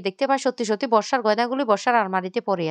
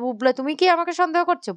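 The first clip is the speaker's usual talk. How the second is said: higher-pitched, hurt disbelief; narrated indignation, pitched up; mildly indignant question; 7 semitones higher than usual. A narrator's reading. Wife asks whether her husband suspects her, mildly indignant.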